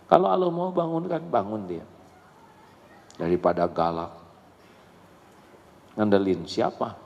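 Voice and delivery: ordinary delivery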